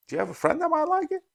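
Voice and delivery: imitating the stranger. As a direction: play the generous stranger offering a taste. Stranger offers Chris a pour.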